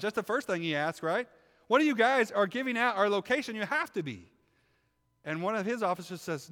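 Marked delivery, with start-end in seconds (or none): 1.7-4.3 high, loud hammed-up accusing; frustrated accusation. King accusing his officers of leaking, loudly frustrated. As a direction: point the blame in exasperation. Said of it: "He gets so frustrated".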